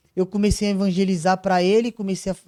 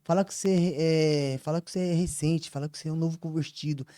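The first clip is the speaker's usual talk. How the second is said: retold inner voice; 3 semitones lower, 7 dB quieter than usual. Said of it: "vem uma voz assim, falando assim".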